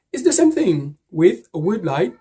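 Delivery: normal talking voice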